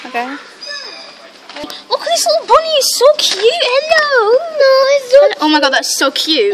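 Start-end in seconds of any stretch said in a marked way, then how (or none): 1.9-5.4 much higher and louder, gushing over the bunny; cooing at bunny. Real, not acted. Seeing a little bunny in the pet shop, squealing baby talk.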